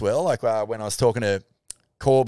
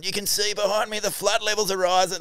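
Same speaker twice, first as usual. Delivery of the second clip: mock TV reporter; 9 semitones higher than usual. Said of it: "like, going".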